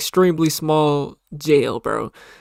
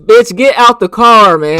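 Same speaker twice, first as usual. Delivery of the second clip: loud yell; 4 semitones higher, 12 dB louder than usual. Real, not acted. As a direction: bark it out, loud and drawn. Yelling at suspect on video.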